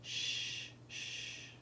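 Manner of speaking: whispered shh; soothing shush. A narrator's reading. Father calming his crying son, soft shushing. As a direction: hush the child softly. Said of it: "patting his head".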